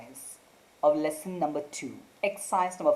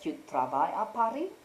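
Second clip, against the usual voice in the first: "Tu travailles à Paris" with a rise on Paris, as a question; questioning intonation; faster than usual. Shown as a demonstration. Teacher recaps the intonation method, questioning rise on "Paris". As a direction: ask "Tu travailles à Paris" by lifting the end of Paris. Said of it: "changing your tone".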